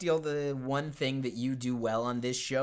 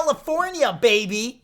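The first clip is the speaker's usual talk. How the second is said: loud, much higher whoop; triumphant shout; 10 semitones higher, 8 dB louder than usual. Real, not acted. Host crowing the guest's hometown, triumphant shout. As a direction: crow the answer in triumph.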